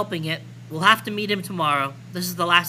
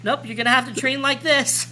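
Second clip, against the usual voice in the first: laughing; 7 semitones higher, 3 dB louder than usual. Acted. Character teasing while laughing.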